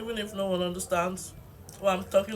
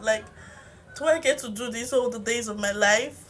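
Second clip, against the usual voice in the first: normal talking voice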